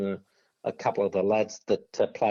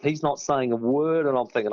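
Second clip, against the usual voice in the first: ordinary talk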